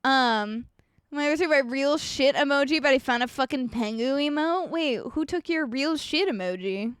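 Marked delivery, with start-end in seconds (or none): none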